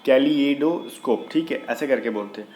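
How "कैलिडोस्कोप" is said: कैलिडोस्कोप said slowly with the li-e sounded; slow, clear teacher's model of कैलिडोस्कोप; slow careful pronunciation. Shown as a demonstration. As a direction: spell out कैलिडोस्कोप syllable by syllable, slowly. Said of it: "फिर मैं बोल रहा हूँ".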